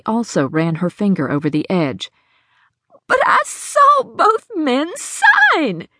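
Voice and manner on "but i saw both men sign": high, slow insistent outcry; crying out. Acted. Hattie protests to the sheriff, crying out. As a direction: insist you saw it. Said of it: "she cried".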